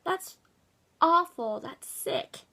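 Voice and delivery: high-pitched, loud burst on "awful"; appalled. Real, not acted. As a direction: cry it out in horror, falling at the end. Appalled reaction to the letter.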